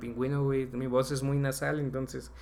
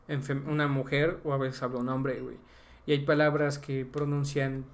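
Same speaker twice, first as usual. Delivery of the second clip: ordinary delivery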